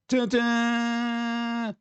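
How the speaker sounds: a sung "Dun, dun" horror-movie sting; dramatic dun dun. Pretend. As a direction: hum "Dun, dun" like an ominous film cue, high. Dramatic sting after horror title.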